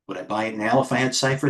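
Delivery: usual speaking voice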